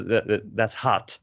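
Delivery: American "hot" with an open ah; American unrounded vowel in "hot"; American English. Shown as a demonstration. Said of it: "in American English, you would say".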